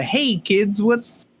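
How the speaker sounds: put-on high cheesy "hey kids" voice; chirpy out-of-touch adult; fellow kids voice. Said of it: "put the meme of the".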